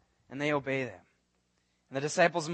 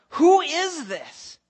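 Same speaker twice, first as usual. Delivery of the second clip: much higher, louder, slow amazed cry; awestruck disciples; 8 semitones higher, 6 dB louder, slower than usual. Acted. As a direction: cry out in stunned wonder. Disciples marvel at Jesus.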